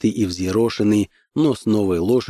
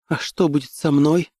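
woman's gentle question, pitched up; high tender voice; 7 semitones higher than usual. A narrator's reading. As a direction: ask in a high, delicate voice. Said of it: "высоким нежным голосом".

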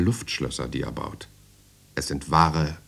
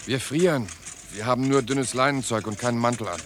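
pleading prisoners; 8 semitones higher than usual. Acted. Prisoners plead against cold.

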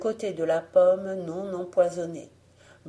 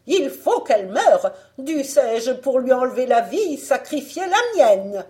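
high, loud, raging queen; fit of rage; 6 semitones higher, 9 dB louder than usual. A narrator's reading. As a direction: rage it out at full force. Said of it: "dans un accès de rage".

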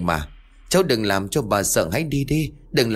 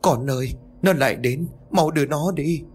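terrified plea; 6 semitones higher than usual. A narrator's reading. Terrified mother clings to son.

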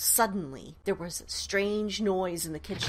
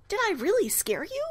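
sweet question; 11 semitones higher than usual. Acted. Ghost asks Paul sweetly.

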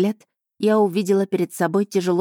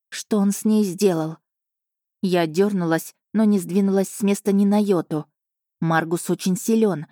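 ordinary delivery

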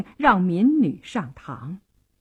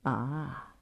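low, soft drawn-out 'ah'; mild, lowered opener; grinning question; 7 semitones lower, 14 dB quieter than usual. A narrator's reading. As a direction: ease into a sly question very softly. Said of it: "他笑嘻嘻地问".

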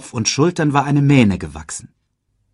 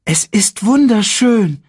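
awed; 11 semitones higher, 7 dB louder, slower than usual. Acted. Simba sees the home.

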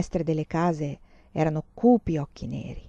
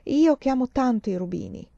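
exclaiming; 6 semitones higher than usual. Acted. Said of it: "esclamò".

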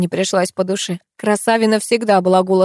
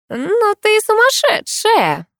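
much higher, slow, thrilled exclamation; delighted; 3 dB louder, much slower than usual. Acted. Katya to narrator, delighted by her crazy plan. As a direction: gush with admiring delight. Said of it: "восторженно произнесла Катя".

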